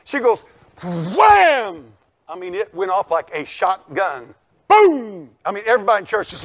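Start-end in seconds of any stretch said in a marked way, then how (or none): 0.8-1.9 mock slam noise. Imitating songbook slammed on concrete. 4.7-5.3 mock gunshot boom. Imitating gunshot-like bang.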